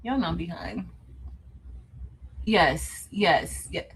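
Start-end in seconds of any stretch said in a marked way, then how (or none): none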